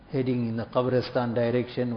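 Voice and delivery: ordinary talk, nothing marked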